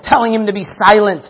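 ordinary delivery

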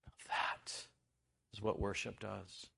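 a preacher hushing the congregation on what worship does; hushed whisper. Acted. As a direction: drop to a whisper to land it. Hushed key point.

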